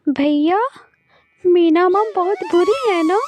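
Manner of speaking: put-on high, plaintive girl's voice; hurt little sister. Sister tells brother stepmother doesn't love them, plaintive.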